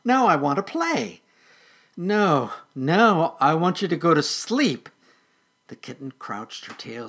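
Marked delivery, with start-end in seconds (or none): 0.0-1.2 high, playful little kitten. Acted. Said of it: "Noodle purred". 2.0-4.9 loud, raised, insistent protest; exasperated refusal. Acted. Weedle refuses kitten's wish.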